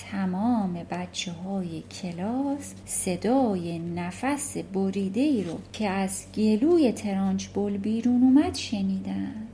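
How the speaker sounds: ordinary talk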